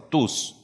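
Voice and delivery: single word "Tus." set apart and pressed; stress on tus. Shown as a demonstration. Preacher pointing the church to one word, stress on "Tus.". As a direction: isolate "Tus." and hit it. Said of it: "El énfasis recae sobre la palabra tus".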